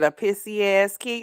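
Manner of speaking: put-on loud incredulous "A pissy ass kid", stress on "ass"; mock quote. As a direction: throw her words back in disbelief. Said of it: "She said".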